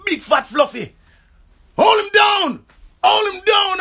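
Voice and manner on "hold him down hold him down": very high, loud barked command; shouted order. Retold or imitated. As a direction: roar the order at the others.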